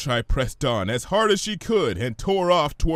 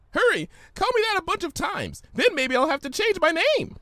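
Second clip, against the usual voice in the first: bubbly Pinky; 13 semitones higher than usual. Acted. Pinky teases Fluttershy, high bubbly voice. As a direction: play it giddy and cheeky.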